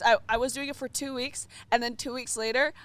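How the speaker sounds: normal talking voice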